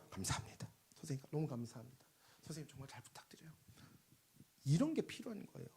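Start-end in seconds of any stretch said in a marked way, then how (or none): 0.1-3.5 soft, breathy thanks to the teacher; humble whisper. Acted. Parent whispering thanks to a child's teacher. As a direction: whisper your gratitude humbly.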